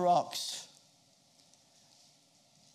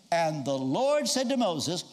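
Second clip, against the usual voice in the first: ordinary delivery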